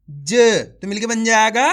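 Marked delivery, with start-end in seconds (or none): 0.1-0.3 ज as a brief consonant with a neutral uh, no long aa; a clipped half-sound ज; half-pronounced final consonant. Shown as a demonstration. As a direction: say ज short and light. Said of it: "यानी कि आधा करेंगे".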